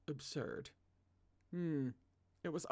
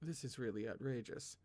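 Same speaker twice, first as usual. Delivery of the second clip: quiet grumble; 3 semitones lower, 7 dB quieter than usual. A narrator's reading. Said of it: "Chu Wanning quietly grumbled".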